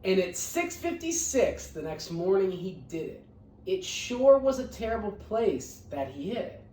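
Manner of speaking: lively read-aloud storytelling; picture book reading voice; story narration. A narrator's reading. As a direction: read it to the class with bounce.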